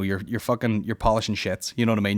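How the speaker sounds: normal talking voice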